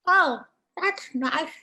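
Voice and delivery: high, slow frog reply; wide-mouthed frog. Acted. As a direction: draw it out in a stretched-mouth frog voice. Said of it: "The little wide-mouthed frog".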